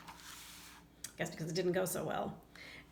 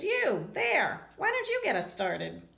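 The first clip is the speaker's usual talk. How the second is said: higher, louder teacher voice calling out; teacher calling on someone; 6 semitones higher, 4 dB louder than usual. Acted. Teacher calling on the old skin, higher louder teacher voice. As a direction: call on the class like a cheery teacher. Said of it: "She says".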